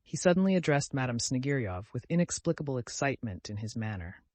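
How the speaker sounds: plain reading voice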